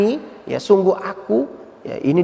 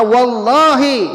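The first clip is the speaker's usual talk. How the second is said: Wallahi stretched out slow and louder, stubborn oath; defiant Bedouin; 6 semitones higher, 5 dB louder, much slower than usual. Retold or imitated. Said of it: "Dia berkata, faqala".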